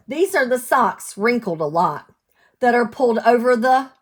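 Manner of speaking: sing-song storybook read; lilting picture-book reading; reading to children. Acted. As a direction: read the rhyme out to little ones. Cumulative rhyme read aloud.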